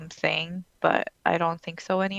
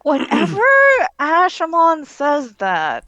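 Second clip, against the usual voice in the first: playful protest; 8 semitones higher, 7 dB louder than usual. Real, not acted. Host doubting a co-host's reassurance, loud and playful. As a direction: raise the voice and stretch the words.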